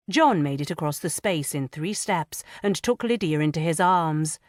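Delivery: usual speaking voice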